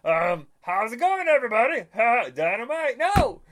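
high loud bursting greeting; loud hammed-up mascot entrance; Kool-Aid Man voice. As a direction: barge in like the mascot. Second try at Kool-Aid Man.

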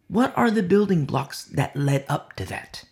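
ordinary delivery

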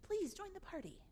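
tender entreaty; gentle pleading. Acted. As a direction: beg softly, pausing after each please. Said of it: "pleads with him".

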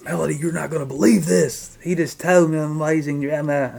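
acting out an excited phone call; excited news-breaking. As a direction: blurt out the big news, pitch up. Retold excited call to wife.

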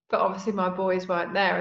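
ordinary talk, nothing marked

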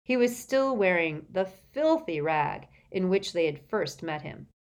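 ordinary delivery

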